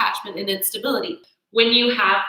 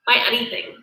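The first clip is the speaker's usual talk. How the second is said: ordinary talk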